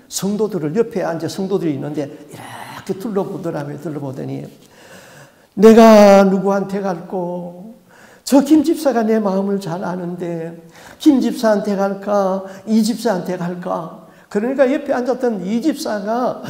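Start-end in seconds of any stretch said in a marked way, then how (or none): 5.5-14.1 the demon choosing whom to enter; sly demon. Retold or imitated. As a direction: size up the congregation. Demon picks next victim.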